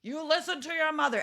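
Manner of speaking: high, forceful fatherly command; put-on scolding father; stern dad voice. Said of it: "Dad probably steps in and says".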